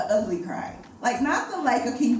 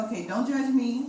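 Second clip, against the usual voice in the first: ordinary talk, nothing marked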